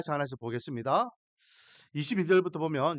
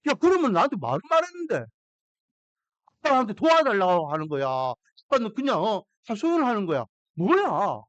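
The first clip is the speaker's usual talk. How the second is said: loud, higher, fast irritated scolding; annoyed dad; 3 semitones higher, 4 dB louder than usual. Retold or imitated. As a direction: snap at the daughter like a fed-up father. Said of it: "제가 빈정상하는 거예요". (딸에게 짜증 내는 아빠처럼 크고 높게 빠르게 쏘아붙이는 말투)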